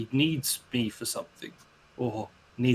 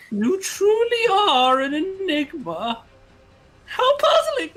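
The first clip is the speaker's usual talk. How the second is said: very high, theatrical villain voice; drawn-out, high-pitched mock wonder from a mysterious figure; Puzzle Master voice; 5 dB louder than usual. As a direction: play a high, eerie puzzle-loving trickster.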